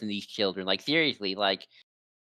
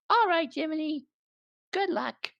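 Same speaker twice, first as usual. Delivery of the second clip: very high little puppet voice; Pinocchio. Retold or imitated. Pinocchio seeing Jiminy off, very high voice. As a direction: squeak it like a small puppet. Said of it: "Pinocchio's like".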